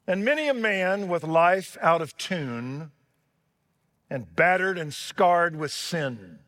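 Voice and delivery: booming, rhythmic recitation of a beloved poem; dramatic poem recital. Acted. Preacher recites the violin poem to his congregation, dramatic and soaring. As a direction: declaim it like a revival preacher.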